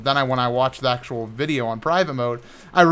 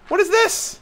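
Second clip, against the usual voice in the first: much higher baffled "what is this?"; surprised; 4 dB quieter than usual. Real, not acted. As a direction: jolt of disbelief, voice jumps up. Suddenly sees something in game.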